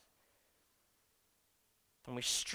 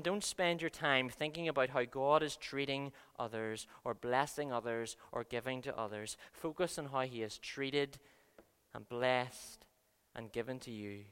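normal talking voice

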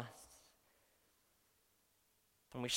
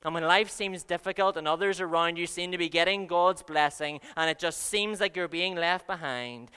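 ordinary talk, nothing marked

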